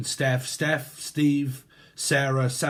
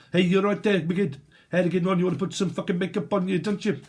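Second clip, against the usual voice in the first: higher mock South African chatter; South African accent; 4 semitones higher, faster than usual. Imitating someone telling off a girl, South African accent. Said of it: "South Africa, innit?".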